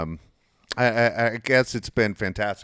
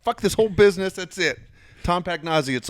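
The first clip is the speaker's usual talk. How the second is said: higher-pitched retold declaration of war; pissed, fed up; 7 semitones higher than usual. Held back by a buddy, declaring it's on, pissed. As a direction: act out having had enough. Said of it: "And I was pissed".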